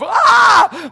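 very high, very loud victory yell; exultant scream. Retold or imitated. Preacher reliving his joy on leaving hospital, exultant scream. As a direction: let out one piercing whoop.

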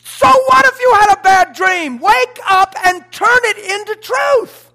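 loud, ringing preacher's declaration; full-force preaching. Acted. Pastor declaring to the church, loud. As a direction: hammer it home from the pulpit.

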